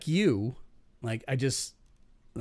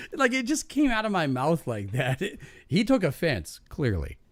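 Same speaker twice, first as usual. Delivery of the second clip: normal talking voice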